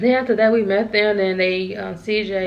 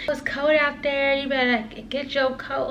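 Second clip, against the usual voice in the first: higher-pitched nagging mom; imitated mom; 5 semitones higher than usual. Mom tells daughter to take a coat, imitated higher. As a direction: voice a mother fussing, pitched up. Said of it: "my mom was like".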